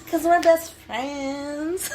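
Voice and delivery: high, slow and drawn out; playful drawn-out declaration; playful gushing. Real, not acted. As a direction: stretch it out, gushing over your best friend. Playful sing-song friendship declaration.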